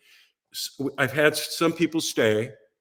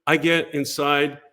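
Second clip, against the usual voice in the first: ordinary talk, nothing marked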